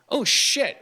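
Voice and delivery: high, startled realization; sudden alarm. Retold or imitated. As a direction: blurt it out in surprise. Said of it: "we finally realize".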